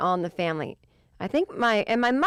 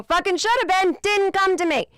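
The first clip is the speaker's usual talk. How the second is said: much higher, louder, exasperated; real frustration; 11 semitones higher, 5 dB louder than usual. Real, not acted. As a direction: wail it at your own bad luck. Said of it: "mad at myself".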